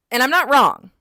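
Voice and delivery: loud, high-pitched declaration; emphatic insistence. Real, not acted. Reader tells viewers her guess was right, emphatic. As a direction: burst out insisting on it.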